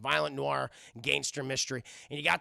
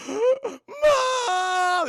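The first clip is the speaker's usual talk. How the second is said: high, loud cry; terrified scream; 10 dB louder than usual. Retold or imitated. Kevin screaming for his mom. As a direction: shriek for mom.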